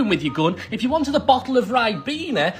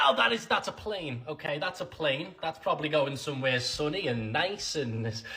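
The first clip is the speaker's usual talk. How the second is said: soft, sinking lower into disappointment; crestfallen; 7 semitones lower, 13 dB quieter than usual. Pretend. Brit realising the blue sky is a plane, crestfallen. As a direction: deflate completely, trailing away quietly. Hope deflates: only a plane.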